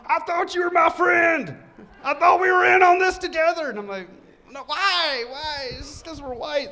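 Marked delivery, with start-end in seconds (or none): 0.1-3.7 high, loud whiny outcry; betrayed wail. Retold or imitated. Zip-tied guy wails. 4.5-6.7 high mock whine; mock indignant. Mock protest of unfairness.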